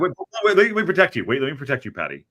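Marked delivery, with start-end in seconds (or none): 0.0-0.6 loud, high mock alarm; mock protective. Jokingly shielding friend from question. 0.6-1.2 high and loud, jokey; loud mock plea. Repeated plea, louder first.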